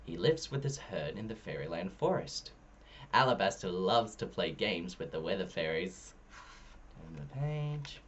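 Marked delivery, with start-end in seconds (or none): none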